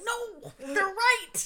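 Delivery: high, loud drawn-out wail; mock-protest, voice shooting up and slowed; mock exasperated yell. Speaker imagines answering people who call them a try-hard, mock wail. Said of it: "I'll be like".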